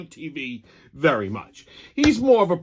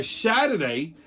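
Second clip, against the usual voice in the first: ordinary talk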